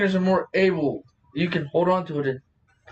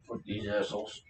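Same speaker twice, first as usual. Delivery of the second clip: muttered aside; 9 semitones lower, 12 dB quieter than usual. Real, not acted. Aside about gym onlookers.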